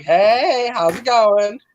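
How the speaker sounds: hammed-up ladies' man hello; confident flirting. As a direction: strut in with a playful come-on. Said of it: "I have so much confidence".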